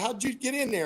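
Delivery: pitched high, curious and amazed; a high, wondering punchline setup; surprised question. Acted. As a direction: ask it like you can't believe he got in. Joke, guy asks about dog.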